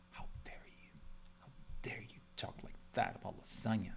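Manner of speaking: whispered mock indignation; breathy pretend offence for lasagna; hushed how-dare-you; mock outrage.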